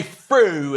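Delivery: "fru", th said as f; imitated British pronunciation. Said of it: "They say".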